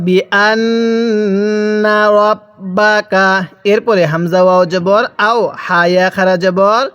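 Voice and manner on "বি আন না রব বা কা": very slow, drawn-out syllables; model recitation. Shown as a demonstration. Teacher models Quran words for learners, slow measured recitation. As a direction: stretch each long vowel evenly. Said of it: "কিভাবে পড়ব".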